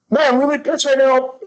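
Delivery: high-pitched mock outburst; acted outburst. Acts out an angry outburst.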